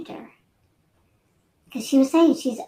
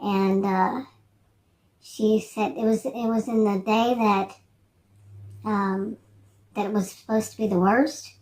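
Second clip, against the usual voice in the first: ordinary talk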